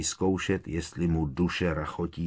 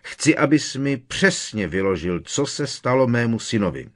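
restrained roar, raised in pitch and volume; roaring demand; 5 semitones higher, 5 dB louder than usual. A narrator's reading. Uncle demanding answers about his son, moderately roaring. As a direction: demand an account, firmly raised voice. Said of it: "zařval strýc Vernon".

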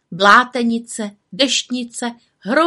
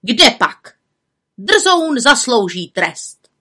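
spiteful aunt; 4 semitones higher, 6 dB louder, much slower than usual. A narrator's reading. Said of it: "Teta Bimbula odvětila".

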